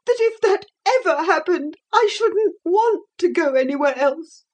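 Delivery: a mild furious sob; understated angry tears; tearful and furious. A narrator's reading. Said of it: "still tearful and furious".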